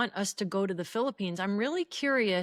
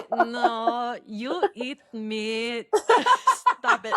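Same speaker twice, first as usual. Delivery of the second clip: bad Filipino accent; 2 semitones higher, 6 dB louder, much slower than usual. Sister attempts brother's Filipino aunt impression, laughing. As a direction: giggle through a terrible Filipino aunt accent. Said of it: "I can't do it. It's terrible".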